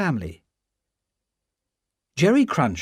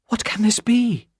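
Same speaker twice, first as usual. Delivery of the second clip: trembling; 9 semitones higher than usual. A narrator's reading. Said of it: "said Lucy, trembling".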